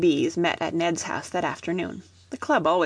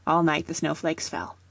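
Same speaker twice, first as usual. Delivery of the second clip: ordinary delivery, nothing marked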